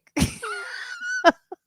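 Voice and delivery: squealing laugh way up high; a sudden shrieking laugh; high burst of laughter. Real, not acted. Laughing at her own mistake, high squeal.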